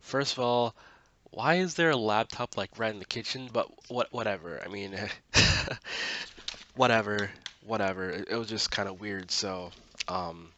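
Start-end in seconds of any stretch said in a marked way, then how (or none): 5.0-6.7 loud breathy laugh; real amused laugh; burst of laughter. Real, not acted. Laughs at the awkward scene.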